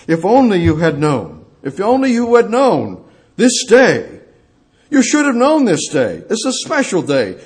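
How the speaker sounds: high, loud, impassioned preaching; full-force preaching. Real, not acted. Preacher crying out to the crowd hailing the King, full-force. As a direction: proclaim it at full voice from the pulpit.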